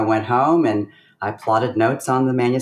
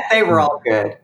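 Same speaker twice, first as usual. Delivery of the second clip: laughing through the words, a little louder; 4 semitones higher, 4 dB louder than usual. Real, not acted. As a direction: laugh it off warmly. Laughing at compliment about notes.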